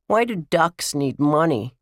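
put-on high-pitched childish question; little boy. A narrator's reading. Brian questions the older kids, little-boy voice. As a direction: ask as a puzzled small child.

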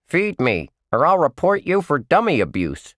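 high cheeky puppet voice; bratty dummy demanding food; ventriloquist dummy. A narrator's reading.

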